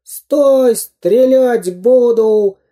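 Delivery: high, loud drawn-out threat; slow squealed command; screeching villain. A narrator's reading. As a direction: screech the warning like a cartoon bandit. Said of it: "верещал Дырка".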